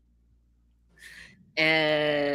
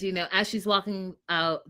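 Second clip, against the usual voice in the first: ordinary talk, nothing marked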